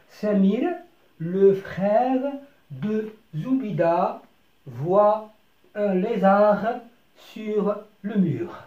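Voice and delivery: very slow, drawn-out classroom reading; slow reading for beginners; slow model reading. Shown as a demonstration. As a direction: read the sentence slowly word by word. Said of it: "teacher models sentence slowly".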